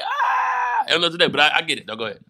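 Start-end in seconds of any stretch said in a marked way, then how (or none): 0.0-0.8 a very high shouted ah; awkward yelp. Retold or imitated. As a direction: squeal like you're embarrassed. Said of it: "I'm just like".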